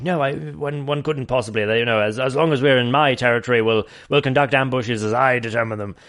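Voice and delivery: mock cut-glass English accent, slightly hushed at first. Podcaster imitating English-sounding Terry objecting, in an English accent. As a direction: send up a very proper English rebel leader. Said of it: "Terry is like".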